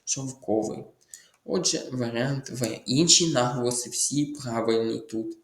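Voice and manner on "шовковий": шовковий said correctly, stressed on the second syllable. Shown as a demonstration. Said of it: "Наголос на другий склад". (шовковий, наголос на другий склад)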